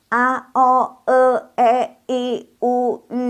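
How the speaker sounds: A, O, E, I, U, each held high and level; model vowels. Shown as a demonstration. Teacher models the Pinyin vowels for pupils, held high and level. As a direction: chant A, O, E one vowel at a time, high and sustained. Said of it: "Jadi bacanya".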